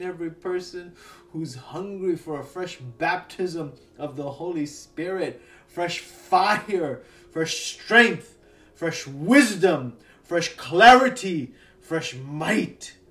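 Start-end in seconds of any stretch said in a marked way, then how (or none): 5.7-13.0 loud, rising preacher's declaration; preaching at full force. Acted. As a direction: proclaim each one louder. Builds declaration of fresh anointing.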